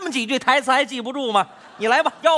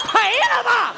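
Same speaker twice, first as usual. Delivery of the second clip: loud hawker's cry; 13 semitones higher than usual. Acted. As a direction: throw it far down the street. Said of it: "声音大一点". (高亢拉长的吆喝)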